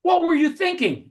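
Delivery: imitating the furious pizza owner; mock yell. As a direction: bark it like an angry boss. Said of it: "he yells at me".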